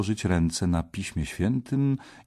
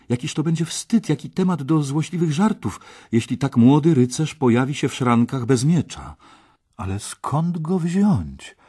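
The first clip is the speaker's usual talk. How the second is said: higher, worried thinking aloud; mild fretting; 7 semitones higher, 4 dB louder than usual. A narrator's reading. Artur dreads his brother's shame without a sword, mild fretting. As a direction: fret over the looming embarrassment.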